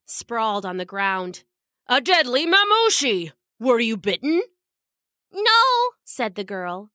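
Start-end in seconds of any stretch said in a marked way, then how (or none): 1.9-4.4 alarmed guard. A narrator's reading. Guard finding a deadly snake beside the princess, alarmed. 5.3-5.6 put-on small high little-girl voice; mildly shaken young princess, pitched up; a little shaky. A narrator's reading. Girl answering the guard after the snake attack, a little shaky. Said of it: "still a little shaky".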